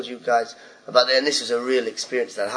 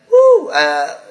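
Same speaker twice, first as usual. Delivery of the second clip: sudden high, loud yelp; jolted by the question; comic taken aback on stage; startled; 11 semitones higher, 12 dB louder than usual. Retold or imitated. Caught off guard by heckle.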